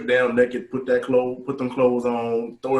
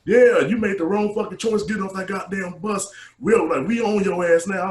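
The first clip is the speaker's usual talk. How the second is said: mock taunting yell; 8 semitones higher, 5 dB louder than usual. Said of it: "they're like".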